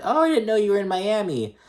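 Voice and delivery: high, fake-innocent surprise; mock feigned surprise. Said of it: "she's like".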